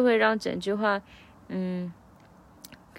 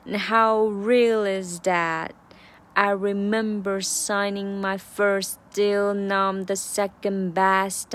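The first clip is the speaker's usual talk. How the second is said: slow, deliberate practice read; 慢速版朗读; slow version; 3 dB louder, slower than usual. Shown as a demonstration. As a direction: read it slowly, word by word. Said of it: "慢速版".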